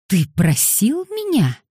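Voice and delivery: high, slow, incredulous fury; furious interruption. A narrator's reading. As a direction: cut him off in fury, pitch way up. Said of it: "яростно оборвал его Маркус". (яростно, высоко, с нажимом)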